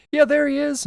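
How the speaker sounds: high, loud eager spotter voice; mock excited onlooker. Onlookers at the lagoon pointing at fish, hammed-up excitement. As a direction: squeal like a gullible gawker.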